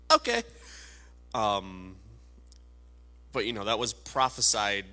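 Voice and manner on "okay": high, loud dismissive "okay"; indifferent okay. Retold or imitated. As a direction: shrug it off, mock-careless. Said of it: "the Romans who were just kinda like".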